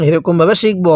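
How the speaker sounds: normal talking voice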